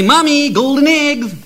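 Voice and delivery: high, slow proud call; proud announcement. Acted. Stupid Jack proudly showing mommy the golden eggs, high and slow.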